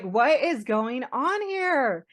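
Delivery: high, slow, drawn-out disbelief; retold incredulous outburst; exasperated disbelief. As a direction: stretch each word, acting out bewilderment. Retelling reaction to freak snowstorm.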